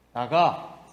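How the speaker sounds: God casting Adam out, sternly; stern expulsion. Retold or imitated. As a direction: order him out of the garden.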